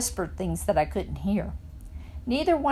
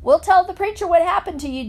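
high, loud parting shout; shouting after someone; 10 semitones higher, 7 dB louder than usual. A narrator's reading. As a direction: shout it after her as she leaves. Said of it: "Dunlap shouted after me".

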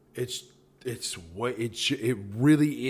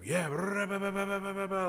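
high-pitched machine-gun gibberish; rapid-fire babble; 8 semitones higher than usual. Retold or imitated. New Yorker talking over everyone at dinner, rapid-fire babble. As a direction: rattle the syllables off nonstop in a high voice. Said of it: "like, real quick with it".

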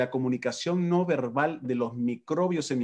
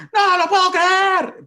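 loud, high, drawn-out exclamation; excited exclamation; 7 dB louder, slower than usual. Retold or imitated. Husband re-enacts how someone told him news, loud and high-pitched. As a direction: blurt it out at full, high volume. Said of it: "Y me dijo".

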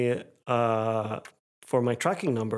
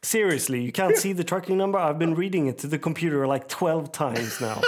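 higher, faster exasperated retort; quick and fed up, laughing at the end; 4 semitones higher than usual. Retold or imitated. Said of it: "I was like".

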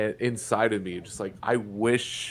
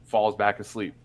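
ordinary talk

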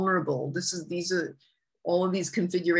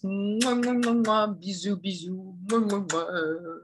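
between kisses. Real, not acted. Blowing kisses to listeners.

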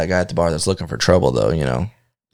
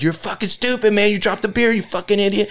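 put-on higher jeering voice of a drunk heckler; hammed-up higher heckling; mock heckler insult; 10 semitones higher than usual. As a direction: taunt him like the loudmouth at the bar. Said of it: "this dude kept fucking talking to him".

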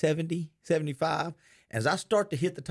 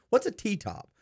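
put-on little girl question; 9 semitones higher, slower than usual. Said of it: "some little girl goes".

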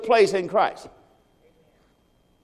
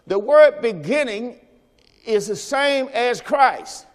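preaching emphasis; 5 semitones higher than usual. Acted.

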